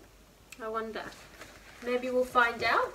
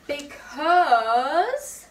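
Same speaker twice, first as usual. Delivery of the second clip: shouted; 3 semitones higher, 8 dB louder than usual. Acted. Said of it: "shouted Lindy".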